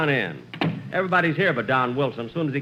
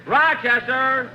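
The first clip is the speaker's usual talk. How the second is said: slow drawn-out call, higher; 6 semitones higher, slower than usual. Acted. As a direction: summon the valet from across the house. Jack calls his valet.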